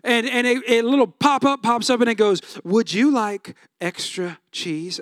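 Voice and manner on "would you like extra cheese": slow, low, sweet app pop-up; a phone pop-up, slow and low, offering extra cheese. Pretend. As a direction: tempt him slowly from the phone.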